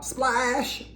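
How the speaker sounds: plain reading voice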